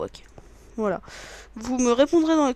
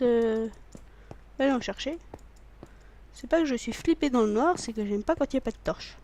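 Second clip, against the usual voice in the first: normal talking voice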